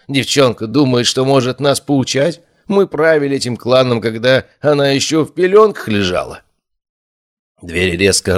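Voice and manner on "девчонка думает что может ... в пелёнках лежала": higher-pitched indignant grumble; grumbling. A narrator's reading. Nairon grumbles about the girl lecturing the elders, grumbling. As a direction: complain about the upstart girl. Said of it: "буркнул Найрон".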